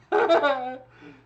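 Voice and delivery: high, loud burst of laughter. Real, not acted. Host laughing while apologising to Mike, high laugh. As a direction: break into giggles. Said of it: "I know I'm laughing".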